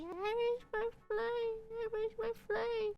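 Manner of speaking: high, drawn-out whiny wail; mock crying. Passenger pleading with airline staff, mock crying. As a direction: wail it slowly like a tearful squeal. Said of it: "And I was crying, gasping".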